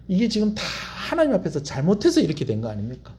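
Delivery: ordinary talk, nothing marked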